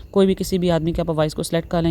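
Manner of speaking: ordinary talk, nothing marked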